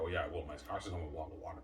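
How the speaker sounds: ordinary talk, nothing marked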